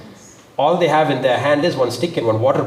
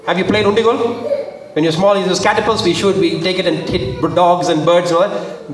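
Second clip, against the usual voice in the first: normal talking voice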